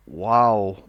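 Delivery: low, loud, drawn-out wow; mock amazement, pitched right down; deadpan deep "wow"; unimpressed wow. Mock awe at visible strings.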